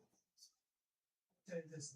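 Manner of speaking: very slow, stress on tell; slow emphatic. Shown as a demonstration. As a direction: drag it out, falling on tell. Repeated phrase, slowed.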